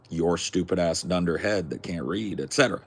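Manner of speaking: ordinary talk, nothing marked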